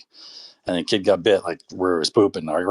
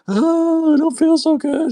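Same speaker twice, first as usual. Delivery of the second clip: put-on much higher and louder, whiny sick kid; sickly kid; 4 dB louder, slower than usual. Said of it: "he's like".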